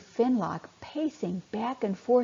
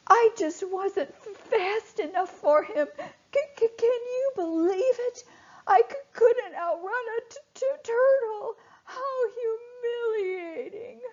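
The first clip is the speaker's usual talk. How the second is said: crying; 13 semitones higher than usual. Acted. Finlock crying after being bitten.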